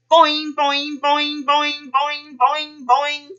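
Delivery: high, loud springy boings; bouncy boing. A narrator's reading. Kangaroo hops out the door.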